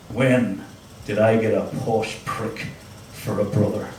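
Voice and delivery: lightly voiced Belfast brother; low, scornful Belfast accent. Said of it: "my very Belfast brother".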